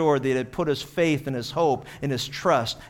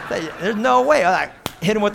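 laughing; 4 semitones higher, 4 dB louder than usual. Real, not acted. Laughing at his own parrot story.

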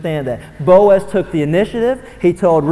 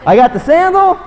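high, loud cry of I got the sandal; loud and high, exultant; triumphant shout; 12 semitones higher, 6 dB louder than usual. Retold or imitated. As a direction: crow it to the crowd. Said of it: "Boaz wasn't shouting".

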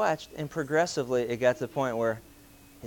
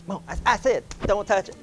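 exasperated parent; 6 semitones higher than usual. Retold or imitated. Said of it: "And you go".

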